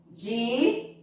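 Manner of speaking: "Dizem" split wide, long rising Di, pause, nasal zem; "Dizem" in two separate syllables. Shown as a demonstration. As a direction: stretch Di, leave a gap, then finish "Dizem" through the nose. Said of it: "Então, estica o som".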